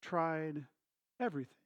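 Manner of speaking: quiet and deliberate, word by word; calm dad. Retold or imitated. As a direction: imitate a patient father, pausing between words. Dad calmly questions frustrated son.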